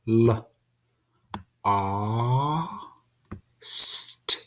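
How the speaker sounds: letters L, A, S, T each isolated, S unvoiced; sounding out lost. Shown as a demonstration. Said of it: "Has those two sounds".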